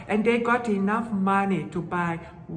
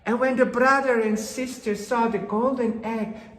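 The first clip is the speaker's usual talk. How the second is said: ordinary delivery, nothing marked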